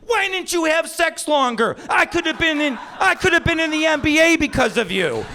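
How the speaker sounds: high-pitched outraged kid yell, imitated; hammed-up tantrum shout; screaming son. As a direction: scream the accusation like a furious child. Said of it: "starts screaming at me".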